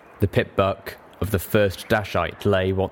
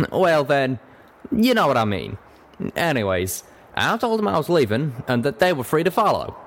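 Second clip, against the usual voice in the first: higher-pitched folksy Western character; drawling cowpony; 6 semitones higher than usual. Acted. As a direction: tell your story as a plain-spoken cowpony.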